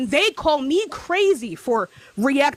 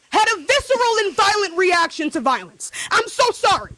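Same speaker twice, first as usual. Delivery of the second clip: sarcastic yelling apology; 5 semitones higher than usual. Real, not acted. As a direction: yell the apology so it sounds like an attack.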